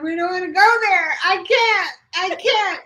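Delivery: normal talking voice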